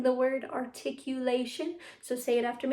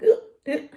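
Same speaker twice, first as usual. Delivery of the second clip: sudden loud, high hiccup noises; imitated hiccup; 6 semitones higher, 9 dB louder than usual. Shown as a demonstration. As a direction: mimic air catching in the throat. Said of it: "air stuck in your throat and you're like".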